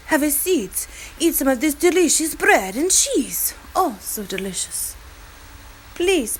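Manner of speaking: hammed-up French accent, pitched high; lilting mock-French host urging guests to eat; French man. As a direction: play a gushing Frenchman with a thick French accent. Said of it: "picture a French man".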